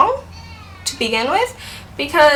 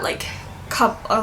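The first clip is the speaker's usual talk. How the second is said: normal talking voice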